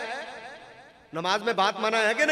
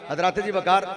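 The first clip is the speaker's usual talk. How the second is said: ordinary talk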